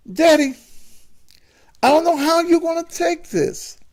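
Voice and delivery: very high, halting son, sigh included; a son about to come out to his father, very high voice; son's high voice. Acted. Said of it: "why are you talking like that".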